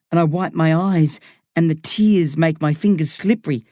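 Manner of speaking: ordinary delivery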